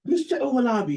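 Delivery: high-pitched imitation of an Oxford don; British Oxford accent. Emeritus professor addressing a student in the dining hall, mock Oxford accent. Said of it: "this is my best British Oxford accent".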